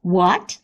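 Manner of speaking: "What" sweeping upward as a question. Shown as a demonstration. Teacher shows the second tone, rising questioning what. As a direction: ask "What" with a clear rise. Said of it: "It's a questioning tone".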